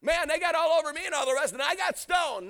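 eager heavenly bragging, voice up; excited testimony. Acted. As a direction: act out a martyr proudly telling his story. Stephen testifying in heaven, imagined.

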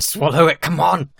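loud, urging, pushing himself on; half-shouted come on; urgent self-coaxing. Retold or imitated. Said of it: "see me just going".

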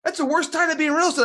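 very high, quick mock grumbling; curmudgeon. Grumpy investor objects to real estate, curmudgeonly. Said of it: "little bit curmudgeon-y at times".

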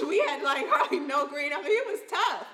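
rueful laugh while talking; half-laughing memory; laughing through it. Real, not acted. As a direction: laugh at how rough it was. Laughing recalling poor old home.

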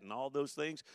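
ordinary delivery

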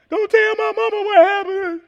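put-on high pleading child voice; childlike plea. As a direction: beg like a scared child. Repeats child's plea, higher.

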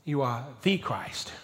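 "the" said as a slow, weighted "thee"; stress on THE. Shown as a demonstration. Preacher shows the definite article matters, stress on "the" as "thee". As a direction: hold back, then lean on "the" as "thee". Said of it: "The definite article is important".

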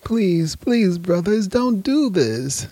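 high, slow, nervous begging; nervous pleading. Acted. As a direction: beg them not to do it. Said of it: "said Hani nervously".

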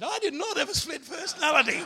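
high and loud, laughing through the words; amused father denying it to his daughter; laughing denial. Retold or imitated. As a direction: protest it while cracking up.

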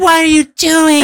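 hammed-up Gina impression.

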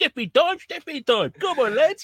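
high-pitched mock-Cockney rallying call; hammed-up Cockney sweep; Mary Poppins chimney sweep. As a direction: call the lads out like a musical sweep. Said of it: "Mary Poppins".

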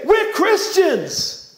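high, loud, drawn-out declaration; loud proclamation. Acted. Preacher mimics people announcing their own faith, loud proclamation. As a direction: proclaim it high and slowly, like a boast. Acting out a boastful self-declaration.

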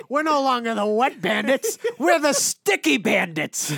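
loud, slow, high goofy burglar voice; Marv impression. Friend retelling Home Alone to a buddy, loud slow goofy Marv voice. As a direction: belt it out like a dopey crook, drawn out. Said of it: "his fucking buddy Marv, he's like".

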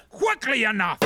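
sharp high retort; irritated king cutting him off; angry king. Acted. Herod snapping at Claudius.

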